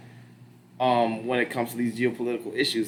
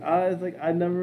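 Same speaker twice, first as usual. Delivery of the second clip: put-on Filipino accent; 7 semitones higher than usual. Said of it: "Terrible Filipino accent".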